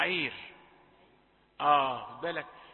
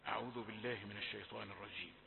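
soft, low, quick murmured formula; hushed invocation; 10 semitones lower, 14 dB quieter, much faster than usual. Real, not acted. Teacher opening recitation for the class, hushed and quick. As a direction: say it quietly and low, almost to yourself.

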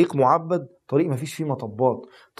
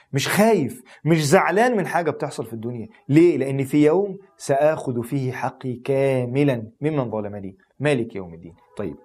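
normal talking voice

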